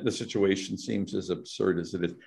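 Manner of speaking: normal talking voice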